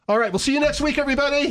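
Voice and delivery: high, bright fake show ending; mock sign-off. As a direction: pretend to wrap the show early as a tease. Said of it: "I'm just kidding, just kidding".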